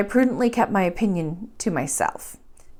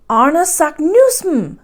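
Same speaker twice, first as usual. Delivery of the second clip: high, loud and slow, a cry of triumph; triumphant cry; 7 semitones higher, 7 dB louder, slower than usual. A narrator's reading. Uncle deciphering the blot, crying the name out triumphantly. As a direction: call the name out in triumph, slowly and much louder. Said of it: "he cried triumphantly".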